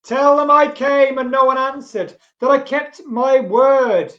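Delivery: loud, high, calling out the line. Acted. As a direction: call it out loud into the night. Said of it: "I'm reading in my loud voice and my, my eerie voice".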